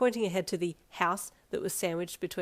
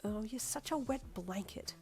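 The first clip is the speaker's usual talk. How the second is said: softer, slow pouty tease; pouting; 3 semitones higher, 8 dB quieter, slower than usual. Acted. Mother pouting at her husband, softer and slow. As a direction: sulk at him like a let-down child. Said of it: "she pouted".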